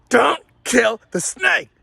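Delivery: "don't kill the snake" word by word, slow and high; emphatic instruction. Retold or imitated. Instructing Dad step one, "don't kill the snake" punched slowly.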